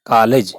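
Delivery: कॉलेज with a long open first vowel, like "kaalej"; कॉलेज said as "kaa-lej"; open English o. Shown as a demonstration. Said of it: "क्या कहते हैं?".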